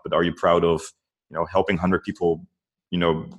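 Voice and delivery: ordinary talk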